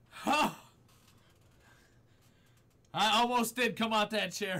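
ordinary talk, nothing marked

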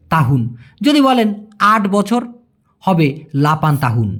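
ordinary talk

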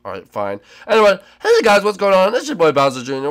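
ordinary delivery

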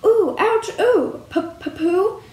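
high, stuttering storybook yelps; mild shriek. A narrator's reading. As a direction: yelp in alarm, stammering on the name.

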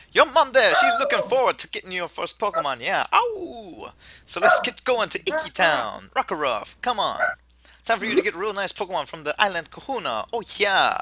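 exuberant bad Jamaican accent with high whoops; attempted Jamaican accent. Kukui hurries the player to town, exuberant attempted Jamaican accent. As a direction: play it up as a hearty would-be Jamaican. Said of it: "I know I can't do Jamaican".

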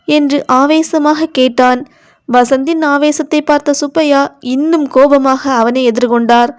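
ordinary delivery

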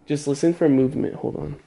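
hushed nervous whisper; nervous night vlog whisper. Real, not acted. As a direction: whisper while sneaking. Sneaking in dark house.

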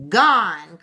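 loud, sudden exclamation; dismayed loud cry. A narrator's reading. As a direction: cry it out loudly in one burst. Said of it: "cried Sean".

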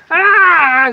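very high, loud cry of pain; desperate scream. Retold or imitated. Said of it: "Grité en mi desesperación". (grito desesperado agudo)

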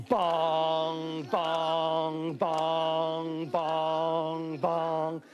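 sung bong like a door knocker; knocking sound. Acted. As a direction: chime each bong evenly. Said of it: "She goes".

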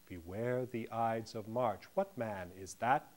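slow, low, measured iambic reading; merged verse line. Shown as a demonstration. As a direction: run "March" into "What man" on one slow, steady beat. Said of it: "Merged together".

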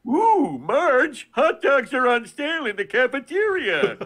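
high, loud cartoon dad voice, drawn-out Ooh; Homer Simpson. Class clown on the school microphone, loud high Homer voice. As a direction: belt it out like a goofy cartoon dad. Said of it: "as Homer Simpson".

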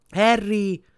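high, loud call. A narrator's reading. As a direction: call the name across a distance.

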